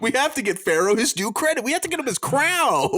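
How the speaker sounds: high, loud and fast mock indignation; mock outrage. Real, not acted. Host demanding Robert get Pharaoh his crown, high mock outrage. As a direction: demand it like a fired-up negotiator.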